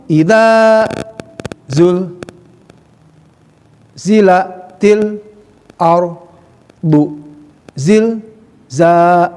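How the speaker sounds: slow word by word recitation; words spaced apart. Shown as a demonstration. Teacher reciting verse word by word. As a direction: recite with pauses between words. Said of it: "Ya, ada mampu".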